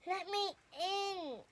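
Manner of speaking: high, slow little rabbit voice at the door; a small animal pleading to be let in; calling Rabbit. A narrator's reading. Rabbit calling to Owl at the door, pleading. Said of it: "called Rabbit".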